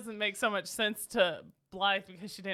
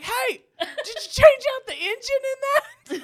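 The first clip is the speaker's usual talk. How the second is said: high, loud, drawn-out holler; excited shout, played for laughs; geeking-out husband; 13 semitones higher, 10 dB louder than usual. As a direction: imitate a husband eagerly calling out a question. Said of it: "My husband was geeking out".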